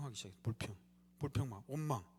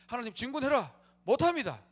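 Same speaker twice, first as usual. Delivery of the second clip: shouted, higher refusal; loud defiant refusal; 7 semitones higher, 9 dB louder than usual. Retold or imitated. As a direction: shout back a flat no to God. People refusing God's command. (큰 소리로 거부하는 외침)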